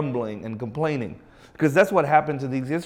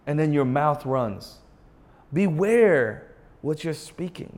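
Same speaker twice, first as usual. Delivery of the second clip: normal talking voice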